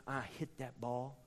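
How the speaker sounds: low and quiet, holding back; hushed telling. Retold or imitated. Preacher recalling the swing in a hushed voice. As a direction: draw them in softly before the hit. Quiet build-up before the big hit.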